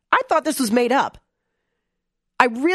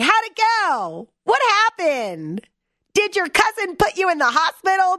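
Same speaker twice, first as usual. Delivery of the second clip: mock cheery email; 8 semitones higher than usual. Campaign email voiced after Thanksgiving, mock cheery. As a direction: play the eager campaign staffer.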